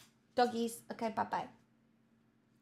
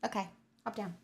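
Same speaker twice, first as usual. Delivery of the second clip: normal talking voice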